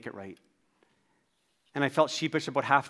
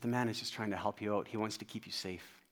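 low, soft, level reassurance; very calm; 5 semitones lower, 11 dB quieter than usual. Retold or imitated. Young construction worker calming an upset dad, very calmly. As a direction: explain it quietly, without any heat. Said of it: "he just said to me very calmly".

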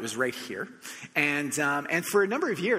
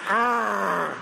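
loud, higher mock groan of annoyance; frustrated groan; 6 semitones higher, 7 dB louder than usual. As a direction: growl out a fed-up argh. Annoyed at the parking fee.

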